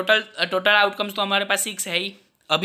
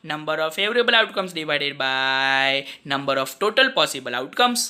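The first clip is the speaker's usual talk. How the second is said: slow dictation; 4 semitones lower, much slower than usual. Acted. Dictating formula slowly.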